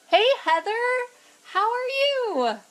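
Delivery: mommy greeting voice. Real, not acted. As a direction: greet her like a cheery mom. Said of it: "here's my mommy voice".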